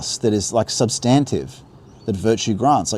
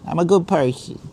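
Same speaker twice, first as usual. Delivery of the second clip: put-on higher, smug New Yorker accent; exaggerated, mocking New York accent; 8 semitones higher than usual. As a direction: ham up the self-satisfied New Yorker. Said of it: "whatever that accent is".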